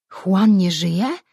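slow, eager question with a lift on Juan; stretched-out excited question. A narrator's reading. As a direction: ask it slowly, hardly daring to hope. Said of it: "zapytał podniecony Surim".

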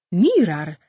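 a loud startled cry of the name; surprised call. Acted. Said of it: "zawołał Surim".

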